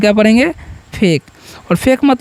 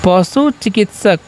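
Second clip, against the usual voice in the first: slow drill; slower than usual. Shown as a demonstration. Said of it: "याद रखना है".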